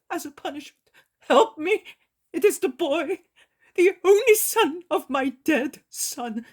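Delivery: high, loud, tearful pleading; an old man begging forgiveness, near tears. Acted. Old man begs Jesus.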